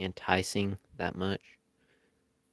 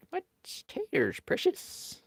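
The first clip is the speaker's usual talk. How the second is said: high, strained creature croak; high rasping Gollum imitation; slower than usual. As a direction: hiss it out like a cave creature. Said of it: "I'm voicing all the wrong voices here".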